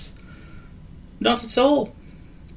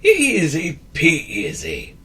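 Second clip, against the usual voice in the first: slow, pleased "Easy peasy"; grinning; 8 semitones higher, 3 dB louder, much slower than usual. A narrator's reading. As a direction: say it with a satisfied grin. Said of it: "grinned Wizard Wheeze".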